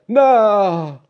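high, drawn-out shout, falling away; shouted cry. A narrator's reading. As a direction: cry it out as the old fisherman. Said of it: "پیرمرد فریاد زد".

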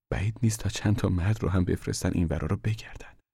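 usual speaking voice

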